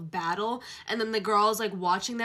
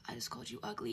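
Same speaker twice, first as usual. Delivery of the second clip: soft sly whisper; teasing whisper; 10 dB quieter, faster than usual. Acted. Hero teasing heroine, sly whisper. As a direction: whisper it with a smirk.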